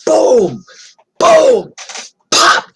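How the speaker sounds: loud, high booms ending in a pop; imitated blows. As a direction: punch out each hit with a gap between.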